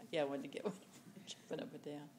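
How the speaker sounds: quick, unvoiced laughing whisper; whispering aside. Real, not acted. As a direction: rush a hushed aside.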